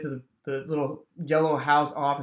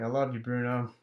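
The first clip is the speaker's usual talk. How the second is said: tender; 4 semitones lower, 7 dB quieter than usual. Real, not acted. Saying goodbye to dying dog.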